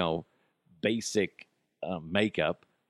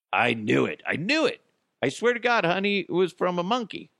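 mock pleading spouse; 7 semitones higher than usual. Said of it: "tell your spouse".

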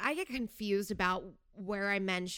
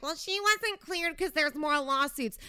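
higher whiny know-it-all voice; mocking smart ass; 6 semitones higher, 3 dB louder than usual. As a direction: parody the smug commenter. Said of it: "smart asses like".

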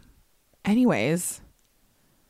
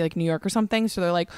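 normal talking voice

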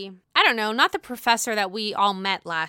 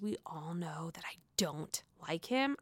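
whispered aside, lower; 5 semitones lower, 10 dB quieter than usual. Real, not acted. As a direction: confide it under your breath. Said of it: "aside admitting bias".

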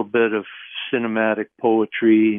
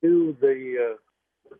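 morning voice; 4 semitones higher than usual. Real, not acted. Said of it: "morning voice version".

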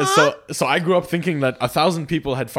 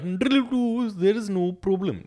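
slow, higher generic Indian accent; 10 semitones higher, much slower than usual. Shown as a demonstration. Showing friends her generic Indian accent, drawn out. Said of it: "the generic Indian accent".

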